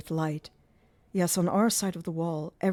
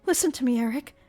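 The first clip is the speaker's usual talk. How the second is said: higher, a touch of a sigh; sighing girl; 8 semitones higher, 5 dB louder, faster than usual. A narrator's reading. Christine sighing to Eric, higher girl's voice. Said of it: "sighed the girl".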